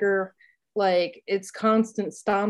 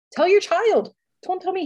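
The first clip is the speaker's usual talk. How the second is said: indignant retort; 10 semitones higher, 4 dB louder than usual. Retold or imitated. Retold argument with husband.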